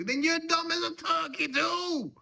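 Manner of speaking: very high, loud furious yell; screamed insult. Acted. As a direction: scream the insult at full volume. Father-in-law yells insult.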